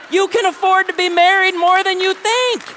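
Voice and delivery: loud, high-pitched shout; forceful rebuttal. Real, not acted. Preacher answering young men's excuse about money, high forceful shout. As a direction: shout it to drive the point home.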